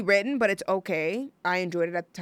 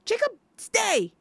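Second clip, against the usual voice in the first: much higher, slow commanding call; mock Bella command; 12 semitones higher, 4 dB louder than usual. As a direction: order him like a dog, mock-dramatic. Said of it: "when Bella tells Jacob".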